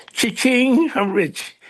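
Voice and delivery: comic cha-ching exclamation; gleeful jackpot. Retold or imitated. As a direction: crow it like you just hit the jackpot. Joking reaction to the director's question.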